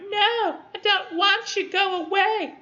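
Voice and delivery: very high squeaky mock protest; mock protesting woman. Student voicing the chased woman refusing her pursuer, high mock protest. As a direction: whine it out in a falsetto, drawn out. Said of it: "Her being like".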